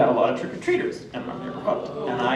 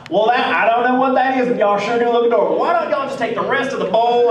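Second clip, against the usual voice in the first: Texas dad voice; 6 semitones higher, 4 dB louder than usual. Acted. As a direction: play a folksy Texas dad, higher voice. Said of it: "I immediately go into my Texas dad voice".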